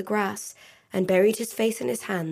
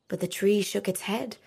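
usual speaking voice

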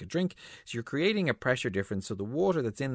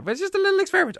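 high-pitched; 8 dB louder, much faster than usual. Real, not acted. Apologetic after soaking her.

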